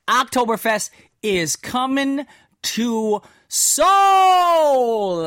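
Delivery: slow, loud, high hype with "Seoul" stretched long; excited announcement. Acted. Radio host hyping the festival to listeners, big slow announcer call. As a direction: sell it like a stadium announcer.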